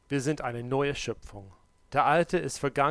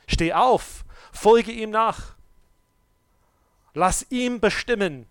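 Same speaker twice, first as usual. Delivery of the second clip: loud, higher, slow command; preaching at full force; 9 semitones higher, 10 dB louder, slower than usual. Acted. Preacher commanding congregation to rise and follow, loud. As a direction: call them up to stand.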